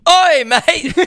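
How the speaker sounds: put-on loud, high British accent. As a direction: bellow it like a London lad in a British accent. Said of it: "if you're doing it in a British accent".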